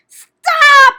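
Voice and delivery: piercing high scream. A narrator's reading. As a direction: shriek it in terror. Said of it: "screamed Wilbur".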